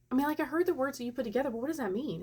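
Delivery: high, quick retold bewilderment; baffled questioning. Baffled patient questions the nurse.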